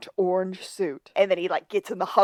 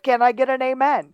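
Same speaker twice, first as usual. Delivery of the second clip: raised, loud preacher's call; preacher calling for amen; 6 semitones higher, 7 dB louder than usual. Retold or imitated. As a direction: rouse the congregation for a response. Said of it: "if the preacher asks for one".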